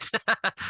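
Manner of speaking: nervous laugh. Real, not acted. Nervous after saying intimidated.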